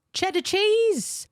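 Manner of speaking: high, stretched-out mock American accent; very slow, high-pitched American. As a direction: exaggerate it in a soaring American voice. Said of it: "in an American accent".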